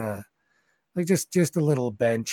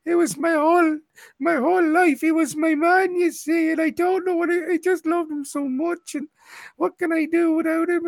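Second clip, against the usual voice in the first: high, breaking voice of a grieving halfling widow, much higher; sobbing widow; 4 dB louder than usual. Acted. Widow to Mick, crying over her dead husband, in a high tearful voice. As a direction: wail it out through tears, pitched way up. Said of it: "She's like".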